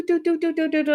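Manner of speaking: high, quick "do-do-do" scurrying sound; playful movement noise. Retold or imitated. Grandma imitating the toddler's head bobbing across the front, high playful noise. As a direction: patter along in a high little voice.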